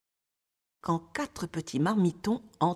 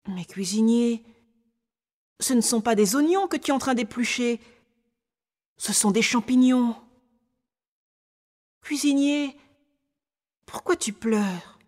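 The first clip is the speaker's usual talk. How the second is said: put-on higher child voice, gently insistent; concerned little boy; 4 semitones higher than usual. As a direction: play a little boy questioning a crying cook. Story's little boy speaks. (petit garçon soucieux, qui insiste doucement)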